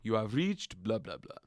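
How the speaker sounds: put-on dad's voicemail in a Persian accent; imitated Persian accent. Son imitating dad's voicemail message, Persian accent. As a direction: mimic a Persian-accented answering machine greeting. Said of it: "the real Persian accent".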